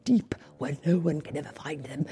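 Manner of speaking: commanding villain. A narrator's reading. Villain ordering the page boy, urgent and secretive. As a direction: command him under your breath. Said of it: "commanded Lord Droon".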